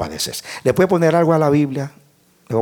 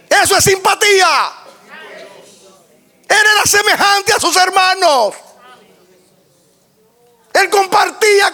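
high, strained pulpit shout; impassioned high-pitched sermon delivery; full-force preaching; 4 dB louder than usual. Real, not acted. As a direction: cry out each phrase with mounting fervour.

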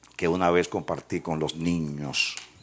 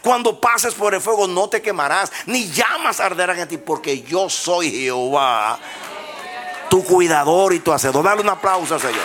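preaching at full force; 11 semitones higher, 8 dB louder than usual. Acted. Preacher proclaiming God's words to the congregation, fervent shout. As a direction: proclaim God's word to the church.

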